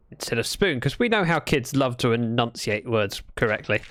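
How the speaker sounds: ordinary talk, nothing marked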